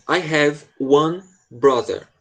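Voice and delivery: very slow, careful teacher read; very slow model line. Shown as a demonstration. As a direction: spell it out slowly for the student. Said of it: "começa assim". (leitura bem lenta e pausada)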